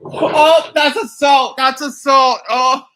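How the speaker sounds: loud, stunned "that's assault"; shocked loud outcry. Real, not acted. Reacting to the threat in the clip, loud shocked outcry. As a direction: shout it in disbelief.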